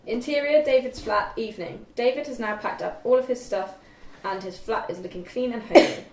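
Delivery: normal talking voice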